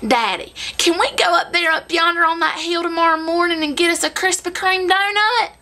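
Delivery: put-on wheedling little-kid voice in one long run. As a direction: imitate an eager country kid begging. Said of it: "then there's the little kids".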